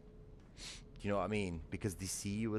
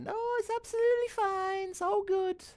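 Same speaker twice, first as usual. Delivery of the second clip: squeaky high falsetto, louder; mocking denial; 6 dB louder than usual. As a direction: parody the oblivious public in a silly falsetto. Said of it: "everybody's got their head in the sands and it's like".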